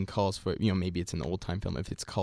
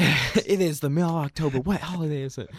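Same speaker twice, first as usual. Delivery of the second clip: higher-pitched mocking interrogation; mock customs officer; 6 semitones higher, 3 dB louder than usual. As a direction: needle the traveller like a suspicious agent. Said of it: "a British guy at U S Customs".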